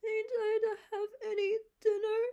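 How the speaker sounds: put-on high, loud crying child's wail; a boy wailing to Marilla about his dinner. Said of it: "wailed Davy".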